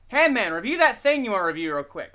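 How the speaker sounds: usual speaking voice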